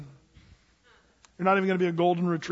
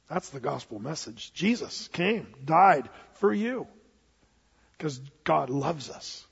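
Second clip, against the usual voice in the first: solemn quiet; 4 semitones lower, 4 dB quieter than usual. Real, not acted. Solemn gospel climax.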